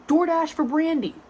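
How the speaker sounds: mock counter call-out; calling out order. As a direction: announce it across the counter. Order called out.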